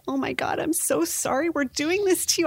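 high, frantic mock panic over the crib; panicky mom. Anxious mom apologizing to crying baby, hammed-up panic. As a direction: ham up a mother falling apart. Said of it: "if you're there like".

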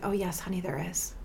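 a quiet, clear, loving reassurance; calm inner voice. Retold or imitated. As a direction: soothe her like a steady inner voice. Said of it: "she was strong, and she was calm".

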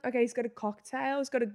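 normal talking voice

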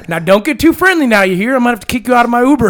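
Southern driver voice. Retold or imitated. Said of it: "Bible Belt".

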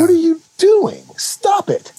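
high, loud mock rebuke; mock scolding. Telling off someone who deserves a slap, high mock scolding. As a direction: squawk an exasperated pretend telling-off.

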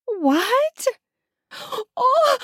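high, surprised question, then a yelp; startled. Acted. Wife interrupted mid-question by a smack, startled. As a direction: break off into a high gasped cry.

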